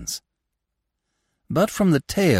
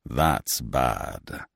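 low, grave pronouncement; 5 semitones lower than usual. A narrator's reading. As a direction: pronounce it like a solemn judge. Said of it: "He was grave as Rhadamanthus pronouncing judgment".

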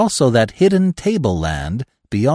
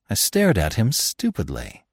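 usual speaking voice

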